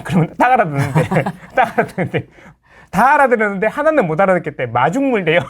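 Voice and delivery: amused, laughter breaking into the words; laughing retelling. Real, not acted. Host retelling clerks' coupon confusion, laughing. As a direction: tell it through laughs.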